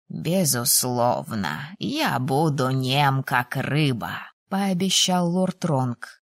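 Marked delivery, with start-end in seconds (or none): 0.1-4.2 low, slow lordly dean; measured pledge in a lowered voice; lord's voice. A narrator's reading. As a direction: give the dean a low, unhurried voice. Dean's pledge voiced by narrator.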